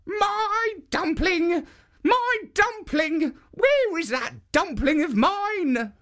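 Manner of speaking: put-on very high, slow calling old woman; old woman crying out. A narrator's reading. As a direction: wail after the dumpling in a high old voice.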